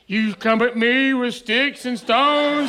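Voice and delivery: slow, hammed-up giant's challenge; mock Goliath taunt. Counselor casting the big camper as Goliath, slow hammed-up taunt. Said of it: "He's just sitting there laughing at me".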